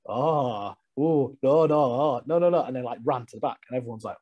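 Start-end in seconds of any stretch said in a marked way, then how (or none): 0.1-2.6 hammed-up despairing wail; mock despair. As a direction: build the panic, no by no. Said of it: "looking at people going".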